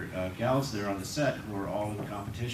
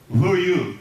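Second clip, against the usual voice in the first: higher and louder, an indignant challenge; retold loud retort; 7 semitones higher, 9 dB louder than usual. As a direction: fire the question back at them. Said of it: "And this is a quote".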